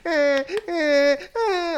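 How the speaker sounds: high, loud mock baby wail; imitated baby crying. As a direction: imitate a wailing baby, high drawn-out bleats. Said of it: "there's just playing a baby crying".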